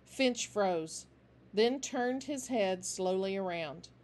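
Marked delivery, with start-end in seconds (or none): none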